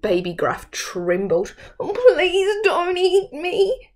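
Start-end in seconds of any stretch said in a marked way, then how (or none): 1.8-3.9 high, slow pleading squeak; squeaking little goat. Acted. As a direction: beg small and scared. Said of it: "he squeaked".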